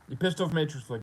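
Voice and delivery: ordinary talk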